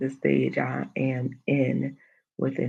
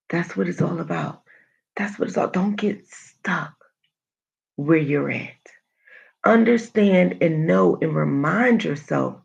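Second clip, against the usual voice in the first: ordinary delivery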